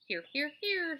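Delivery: high, loud honking triple call; goose honking. Acted. The goose answers Charlotte's roll call, honking. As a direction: honk it like a goose. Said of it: "said the goose".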